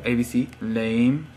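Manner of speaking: ordinary talk, nothing marked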